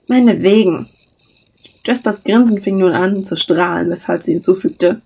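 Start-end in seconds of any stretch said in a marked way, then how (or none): 0.1-0.9 resigned sigh, a bit louder; grudging yes on a sigh; sighing reluctant. A narrator's reading. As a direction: give in with a weary sigh. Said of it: "Scar seufzte".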